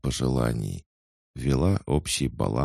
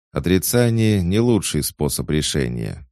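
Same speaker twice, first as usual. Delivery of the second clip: snooty cat, raised and scoffing; snorting cat; 5 semitones higher, 6 dB louder than usual. A narrator's reading. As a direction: scoff at him like a haughty cat. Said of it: "фыркнул кот".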